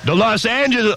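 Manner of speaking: ordinary talk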